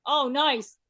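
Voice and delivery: normal talking voice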